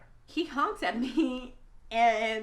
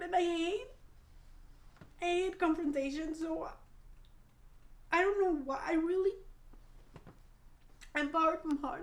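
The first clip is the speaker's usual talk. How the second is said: shaky upset; 7 semitones higher than usual. Real, not acted. Upset recounting abuse.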